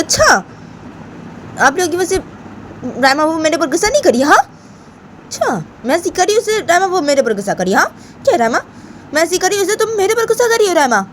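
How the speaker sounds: put-on squeaky high girlish protest; childish protest. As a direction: protest your innocence like a sulky girl. Bani challenges Rama.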